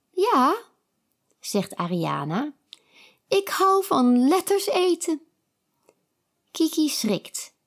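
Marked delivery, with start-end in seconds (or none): 0.1-5.2 high, odd voice; strange lady. A narrator's reading. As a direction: play the peculiar princess. Ariana's strange voice.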